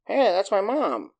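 a lightly voiced young boy claiming his mother; kid voice. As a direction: play the lost kid turning up at the door. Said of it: "this kid rolls up out of nowhere and is like".

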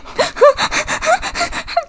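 high squeaky giggle. Real, not acted. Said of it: "You sound like an anime girl".

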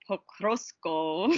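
put-on slow, careful Russian accent. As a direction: say the town name like a Russian. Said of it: "That was good".

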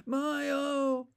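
sudden high anguished shout; an old man crying out in distress. A narrator's reading. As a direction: cry out in alarm, high and loud. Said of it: "he shouted".

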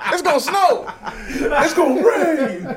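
high, hammed-up weather forecast voice; mock weatherman. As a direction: play a silly TV weatherman, laughing. Mock weather report after reporter bit.

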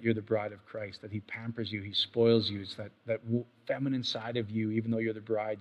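soft, hushed prayer and count-down; quiet prayer voice. Acted. Pastor leading his listeners into quiet prayer, hushed and calm. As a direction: draw them gently into rest.

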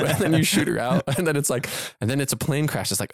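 ordinary talk

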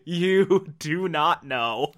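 slowed right down, each word weighed; slow ominous repeat. Real, not acted. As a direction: let it sink in slowly. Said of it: "repeats it slowly".

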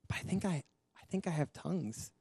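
soft, halting admission; hesitant confession. Retold or imitated. Husband tells his wife he thinks he has tongues, hesitant. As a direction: confess it quietly, unsure of yourself.

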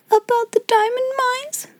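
put-on very high, small child's plea; whimper. A narrator's reading. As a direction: ask timidly with a rising, tearful lilt. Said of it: "whimpered Lottie".

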